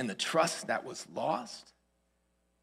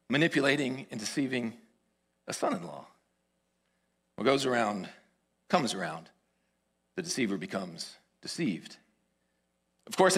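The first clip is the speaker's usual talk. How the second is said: ordinary delivery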